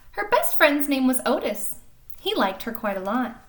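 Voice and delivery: lively storybook reading voice; animated picture-book narration; reading to children. A narrator's reading. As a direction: read it like story time for little kids. Reads picture book aloud.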